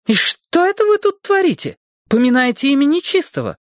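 high, scolding woman through the door; a stern housekeeper demanding entry; bossy housekeeper voice. Acted. Essie scolding the children. (голос сердитой домработницы)

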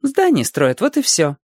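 put-on high-pitched child's voice; little boy. A narrator's reading. Small boy answering the watchman, childlike. Маленький мальчик отвечает сторожу.

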